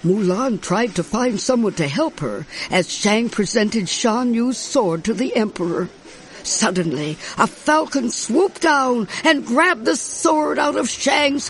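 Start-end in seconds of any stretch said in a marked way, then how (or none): none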